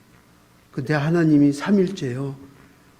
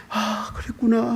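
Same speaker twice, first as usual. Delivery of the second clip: realization; 6 semitones higher, 4 dB quieter, slower than usual. Retold or imitated. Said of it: "하면서".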